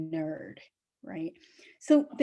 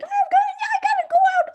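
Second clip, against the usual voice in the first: very high, fast squeaky puppy; 7 dB louder, much faster than usual. Retold or imitated. Puppy begging to go out at night, squeaky high urgent voice. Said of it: "the little puppy's like".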